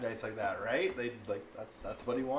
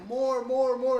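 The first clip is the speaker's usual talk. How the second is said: put-on much higher and louder, a demanding little kid; toddler begging; 14 semitones higher, 11 dB louder, slower than usual. Mom imitating her son begging for more truck videos, high whine. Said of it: "he's like".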